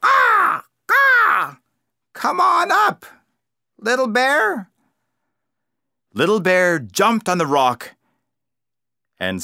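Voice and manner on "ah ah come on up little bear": a much higher, louder crow call from the top. A narrator's reading. Crow calls Little Bear up from the rocks, a high, loud crow call. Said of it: "called down".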